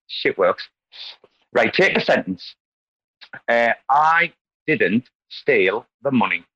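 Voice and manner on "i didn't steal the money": "I didn't steal the money" slow and even, no word stressed; every word of "I didn't steal the money" given equal weight, slowly; monotone. Shown as a demonstration. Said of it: "Very monotone".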